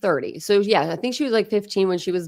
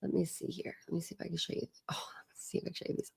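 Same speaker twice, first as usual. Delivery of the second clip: whisper; 18 dB quieter, much faster than usual. Real, not acted. Showing photos off camera.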